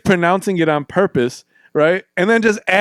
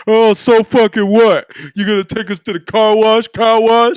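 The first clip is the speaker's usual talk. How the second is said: put-on high, loud jeering kid voice; taunting schoolkid; 6 semitones higher, 4 dB louder than usual. Schoolyard bully mocking his last name, loud and high jeer. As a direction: jeer at him like a playground bully.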